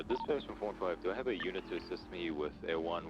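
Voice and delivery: ordinary talk, nothing marked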